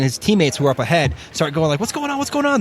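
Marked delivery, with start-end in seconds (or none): 1.8-2.6 high alarmed calling; high imitated panicked calls; confused soldiers. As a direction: call out in alarm. Teammates confused shout.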